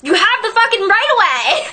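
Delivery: high, laughing indignation; indignant outburst. Real, not acted. Indignant outburst about right of way.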